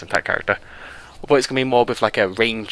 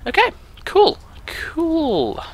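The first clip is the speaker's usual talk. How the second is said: squeaky okay cool; much slower than usual. Real, not acted. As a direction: squeak it out in a silly high voice. Sudden squeaky falsetto reaction.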